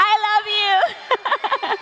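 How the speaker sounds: high, slow, giggly I love you; laughing love. Real, not acted. Founder answering a shouting fan, slow and giggling.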